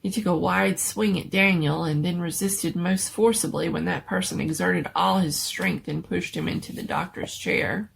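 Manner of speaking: ordinary delivery, nothing marked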